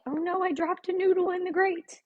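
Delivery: high-pitched oh-no; dismayed exclamation. Real, not acted. Cook drops a noodle in the grate, high dismay. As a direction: yelp in light dismay at a small mishap.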